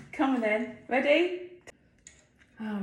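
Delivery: ordinary delivery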